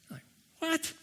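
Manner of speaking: high, squeaky disbelief; can't believe what he just heard; incredulous what. Pretend. Preacher reacting to Jesus' command, squeaky incredulous what. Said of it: "reacting to do not be afraid".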